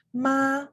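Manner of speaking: "Ma" high and level; first tone. Shown as a demonstration. Teacher's example from the video, high level "Ma". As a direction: hold "Ma" on a high even pitch. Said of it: "Ma means mother".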